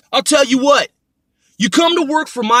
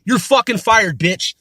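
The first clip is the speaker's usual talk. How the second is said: ordinary talk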